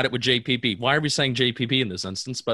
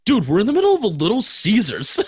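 quoting the punchline; 8 semitones higher, 4 dB louder than usual. Retold or imitated. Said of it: "two was".

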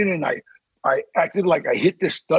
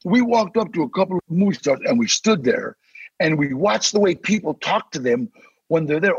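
ordinary talk, nothing marked

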